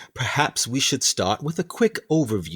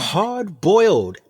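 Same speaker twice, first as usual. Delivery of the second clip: drawn out and relished, pitched up; savoring the word; 7 semitones higher, 4 dB louder, slower than usual. Acted. Captain savoring the reporter's phrase, relishing it. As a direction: roll the phrase around like a fine wine.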